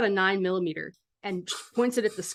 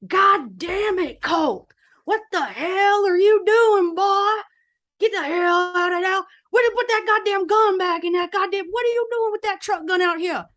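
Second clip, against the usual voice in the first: furious yelling; 11 semitones higher, 8 dB louder than usual. Acted. Sheriff hollering at his boy across the street, yelling. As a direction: bellow like an enraged father. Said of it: "He's yelling at him".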